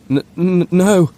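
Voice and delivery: high, stammering, frightened whimper. A narrator's reading. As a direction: stutter it out in terror. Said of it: "he whimpered".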